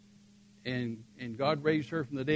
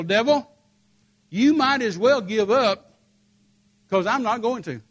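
loud, high, measured defiance; defiant command; 8 semitones higher, 8 dB louder than usual. Retold or imitated. Preacher telling the devil to give up, loud defiance. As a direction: serve notice in a raised, emphatic voice.

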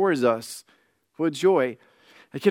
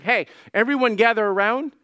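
calling out; 6 semitones higher, 3 dB louder than usual. Acted. Father calls the crowd together.

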